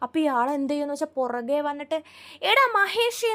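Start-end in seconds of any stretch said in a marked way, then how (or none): 2.5-3.3 much higher, louder call to a friend; loud hail. Retold or imitated. Man pretending to hail his friend from behind, loud call. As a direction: shout out as if spotting a buddy. Calling a friend, slaps back.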